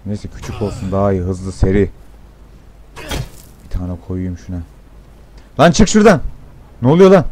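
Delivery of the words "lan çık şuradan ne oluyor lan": sudden high, loud yell at the screen; startled yell. Real, not acted. Gamer startled by an enemy, yelling high and loud. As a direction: shout in alarm at what's on screen.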